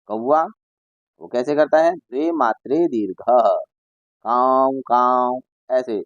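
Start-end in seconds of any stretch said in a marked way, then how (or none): 4.3-5.4 slow, stretched caw of a crow. Shown as a demonstration. Teacher imitating the crow's long caw for pupils. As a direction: caw like a crow, each vowel held long. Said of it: "दीर्घ स्वर में बोलता है".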